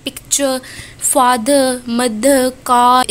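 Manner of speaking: picture, father, mother, car spoken very slowly with no final R; final R dropped. Shown as a demonstration. Teacher slowly models non-rhotic endings on picture, father, mother, car. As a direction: stretch out father and mother, R left silent. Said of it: "R sound is always dropped finally".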